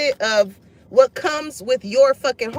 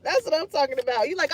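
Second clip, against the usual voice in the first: ordinary talk, nothing marked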